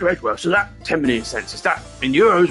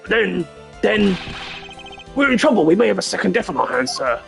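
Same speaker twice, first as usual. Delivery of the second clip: high, loud panic in character; stammer, then blurt it out in alarm; 8 semitones higher, 4 dB louder than usual. Acted. Fears a second death on hand.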